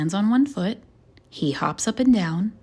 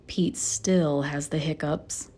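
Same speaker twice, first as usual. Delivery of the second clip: usual speaking voice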